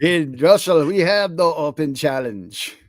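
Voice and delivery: mock Russian menace; hammy Russian villain voice; heavy Russian accent menace. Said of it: "He should do a full Russian".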